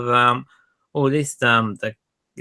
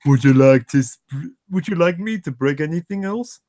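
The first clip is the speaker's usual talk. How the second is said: taunting character voice; 3 semitones higher than usual. Retold or imitated. Repeating Ned's line.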